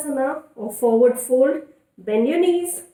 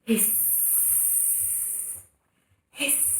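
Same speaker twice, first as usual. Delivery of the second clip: soft, breathy snake hiss; quiet playful snake sound; 3 semitones lower, 12 dB quieter than usual. Pretend. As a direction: hiss like a cobra. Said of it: "And let's go".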